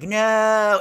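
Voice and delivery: sudden high, loud cry of disbelief; fan's shocked outcry; anguished no. Retold or imitated. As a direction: yell it as the hero dies. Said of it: "I was like".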